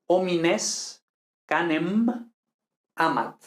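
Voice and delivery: homines canem amat, slowly, final M and T clear; final consonants sounded. Shown as a demonstration. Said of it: "cuidar la pronunciación de consonantes finales".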